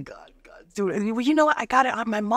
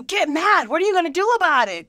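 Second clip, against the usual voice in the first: fired up; 6 semitones higher, 3 dB louder than usual. Retold or imitated. Coach firing up the crowd.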